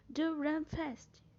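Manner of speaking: high, slow "Do run fast"; deliberate, pressing command demo; emphatic imperative. Shown as a demonstration. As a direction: draw out "Do run fast" with insistence. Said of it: "pra dar mais ênfase".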